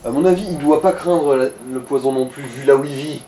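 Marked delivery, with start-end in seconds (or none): none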